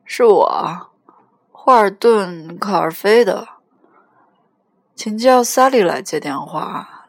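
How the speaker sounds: put-on loud, drunk phone voice; almost holler down the line, a little drunk; drunken near shout. A narrator's reading. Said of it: "几乎是在大声吆喝".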